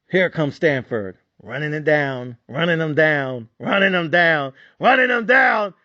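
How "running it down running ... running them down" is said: mounting dread. Retold or imitated. Bettor recounting Stanford's drive, mounting alarm. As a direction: build each repeat toward a shout. Retells opponent's relentless drive.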